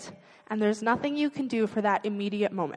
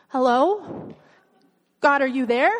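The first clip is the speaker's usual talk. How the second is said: mock worried call, a little louder, rising; anxious calling out; 2 semitones higher, 3 dB louder than usual. Preacher mimicking a panicked prayer from the pit, mock anxious. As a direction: call into the dark, unsure anyone hears. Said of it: "I'm more tempted to pray something like this".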